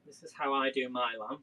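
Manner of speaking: ordinary delivery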